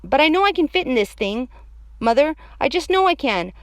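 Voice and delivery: put-on loud, high child's protest; high, pushy kid voice; insistent little girl.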